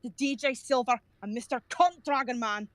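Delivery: rolling Scottish brogue, mock-rude; Scottish accent. Scottish Pokemon trainer voice greeting the podcast hosts. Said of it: "the guy that did the Scottish accent".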